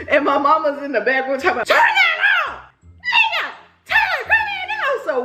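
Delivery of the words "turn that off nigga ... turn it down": extremely high, screechy mock shout; imitated hollering mama. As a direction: screech an order from the back room. Mama hollering from back room.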